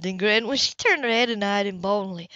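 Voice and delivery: ordinary delivery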